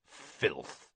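low, soft growl of contempt; a hint of a gruff old Auror growling. A narrator's reading. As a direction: spit the word out in a growl. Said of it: "growling voice saying".

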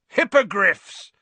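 loud, high, drawn-out delight; happy roar. A narrator's reading. Hagrid presenting creatures to his class, happy roar. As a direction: roar it out as a proud reveal. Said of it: "Hagrid roared happily".

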